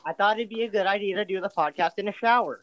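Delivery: talking in a shower stall; echoey shower voice over water; over running shower. Real, not acted. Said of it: "I'm in the shower".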